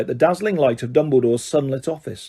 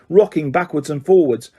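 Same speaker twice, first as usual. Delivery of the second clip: usual speaking voice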